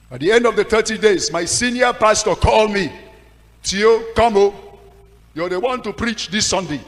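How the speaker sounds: high, loud summons; excited call. Retold or imitated. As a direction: announce the good news loudly. Pastor calling him to preach.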